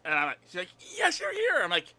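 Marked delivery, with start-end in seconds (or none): 1.0-1.6 very high squeal of delight; thrilled high-pitched cheer; excited greeting. Retold or imitated. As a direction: jump on someone you've waited for. Friend excitedly greets her.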